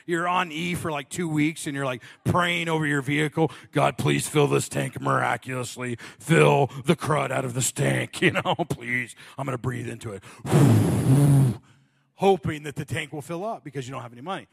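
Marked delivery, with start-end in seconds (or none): 3.7-10.2 mock prayer. Mock prayer over the car. 10.3-11.6 loud hammed-up exhale into the tank; big mock breath. Student breathing into his gas tank, a loud mock breath.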